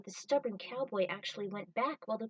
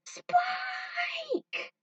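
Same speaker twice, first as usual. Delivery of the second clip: very high crying scream; anguished wail; 5 dB louder than usual. Acted. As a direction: wail as the base blows up. Base explodes with Spike inside.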